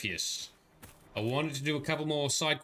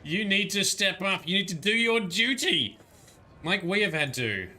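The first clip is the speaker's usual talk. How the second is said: a raised, pressing mock lecture with a laugh in it; mock stern lecture; 5 semitones higher, 4 dB louder than usual. Streamer playfully lectures the noble-shy character, mock stern. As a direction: push the duty speech on her, half joking.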